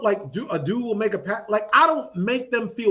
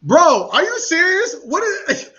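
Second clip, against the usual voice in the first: high, loud, slow cry of disbelief; can't believe what you're seeing; outraged disbelief; 9 semitones higher, 9 dB louder than usual. Retold or imitated. Retelling the botched taco order, shocked disbelief.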